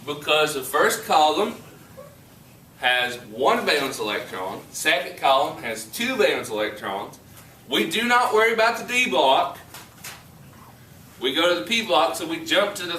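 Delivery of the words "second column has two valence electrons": ordinary talk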